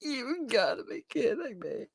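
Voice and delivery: high, disbelieving reaction; can't believe what you just read; incredulous. Real, not acted. Vlogger reacting to a hacked-account excuse, incredulous.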